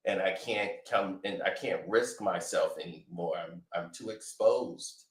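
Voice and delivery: tired son pleading with his father; Drake scolding dad. Retold or imitated.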